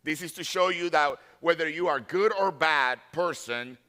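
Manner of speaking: usual speaking voice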